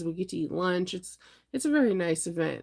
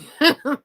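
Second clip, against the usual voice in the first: loud laugh; 8 semitones higher, 11 dB louder than usual. Real, not acted. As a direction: burst of real laughter. Laughs at surgery thought.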